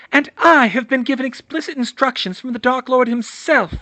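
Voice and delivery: high, loud mad witch voice; hissed fury. Acted. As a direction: cut him off venomously. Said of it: "she hissed".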